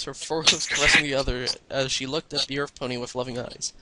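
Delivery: ordinary delivery, nothing marked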